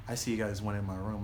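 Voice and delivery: low, hushed confrontation; quiet menace. Retold or imitated. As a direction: voice dropped, ominous and soft. Menacing host confronts them.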